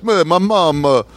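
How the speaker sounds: high-pitched, hammed-up Italian accent; terrible Italian accent. Mimicking an Italian comic's act, terrible Italian accent. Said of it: "that's a terrible Italian accent".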